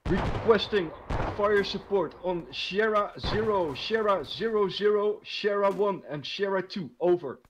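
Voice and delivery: loud, slow formal radio request; radio procedure voice. Acted. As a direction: read it like military comms.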